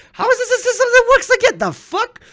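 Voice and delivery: high, fast, laughing disbelief. Real, not acted. Ranting to a friend about American work, laughing disbelief. Incredulous rhetorical question, laughing.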